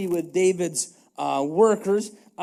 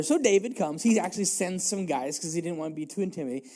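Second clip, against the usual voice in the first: normal talking voice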